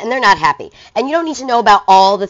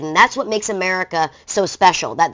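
ordinary delivery

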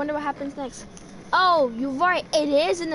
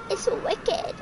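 much higher, playful repeat; playful put-on high voice; 6 semitones higher than usual. Gamer echoing himself at the creepy asylum, playful high voice. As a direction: echo it in a high, silly voice.

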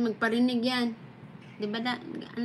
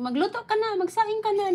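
high, loud mock yell of a mom; mother shouting; 10 semitones higher, 5 dB louder than usual. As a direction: shout orders like a nagging mother. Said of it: "pag sinigawan ka, tulad ng nanay".

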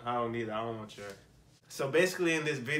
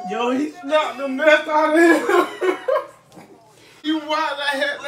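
laughing loudly while talking; 12 semitones higher, 8 dB louder than usual. Real, not acted. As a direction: talk through loud laughter. Laughing at the clip.

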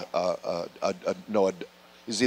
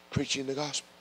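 quiet reply; 2 semitones higher, 13 dB quieter, faster than usual. Retold or imitated. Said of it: "I said".

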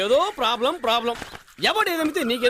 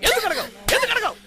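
exasperated demand; 7 semitones higher than usual. Acted. Father repeating his demand, rising.

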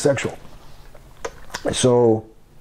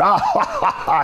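mock hearty cackle, much higher; imitated laugh. Imitating the boss laughing at the prank. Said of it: "the boss is going".

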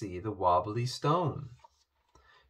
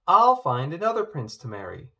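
loud, high, firm declaration; a defiant princess declaring; declared; 5 semitones higher, 7 dB louder than usual. A narrator's reading. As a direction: announce it boldly to everyone. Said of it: "Poppy declared".

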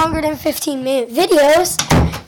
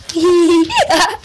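louder come on breaking into a laugh; laughing urging; 4 dB louder than usual. Real, not acted. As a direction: laugh through the plea.

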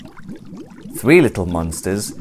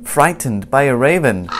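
ordinary delivery, nothing marked

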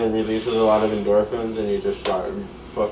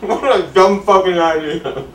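higher, louder, laughing out the words; 9 semitones higher, 8 dB louder than usual. Real, not acted. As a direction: crack up while talking. Laughing while eating crackers.

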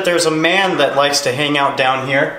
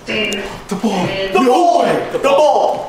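much higher, louder yelling; startled shout; 9 semitones higher, 3 dB louder, much slower than usual. Real, not acted. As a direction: yell in shock as it drops. Ball falls, startled cries.

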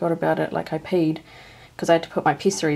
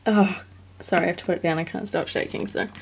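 ordinary talk

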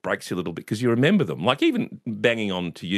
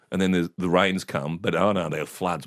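normal talking voice

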